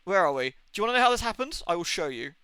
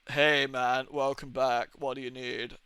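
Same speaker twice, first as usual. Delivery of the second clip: Trip impression; 6 semitones lower, slower than usual. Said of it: "I sound like Trip from Vampire the Masquerade".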